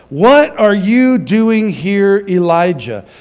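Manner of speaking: a solemn divine question; God's voice. Shown as a demonstration. God calling Elijah in the cave, slow solemn.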